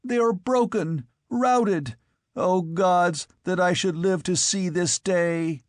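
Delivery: despairing squire. Acted.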